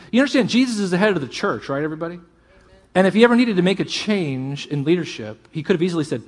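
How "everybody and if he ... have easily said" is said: ordinary talk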